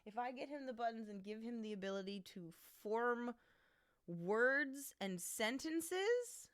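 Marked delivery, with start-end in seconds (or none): 2.8-6.5 words drawn out slow and loud; slow emphatic. Real, not acted. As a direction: stretch each word for drama. Dramatic emphasis.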